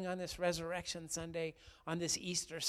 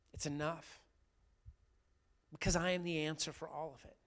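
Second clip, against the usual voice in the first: a lightly voiced, gentle Jesus swelling louder; tender Jesus voice; 9 dB louder than usual. Jesus comforting ashamed Peter, lightly voiced and tender. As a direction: reassure him, then lift it.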